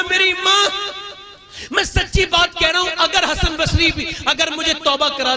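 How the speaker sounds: tearful plea. Retold or imitated. Dying son pleading with mother.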